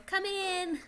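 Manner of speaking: high, small piping voice; tiny little voice. Acted. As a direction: squeak it out like a tiny creature. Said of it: "you hear a tiny little voice go".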